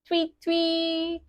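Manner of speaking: high spoken tweets; bird tweet. Acted. Teacher voicing the book's bird, high tweets. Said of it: "goes the bird".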